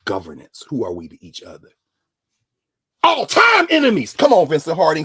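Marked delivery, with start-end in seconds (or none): 3.0-4.1 slow, high, loud proclamation. Acted. Preacher calling out to the crowd, loud and slow. 4.1-5.1 loud call-out. Acted. Preacher calls out to a listener.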